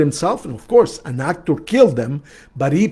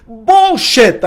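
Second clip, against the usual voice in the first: louder, higher retold snap; blunt retort; 7 semitones higher, 6 dB louder than usual. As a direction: call it out sharply. Counselor's retold blunt rebuttal.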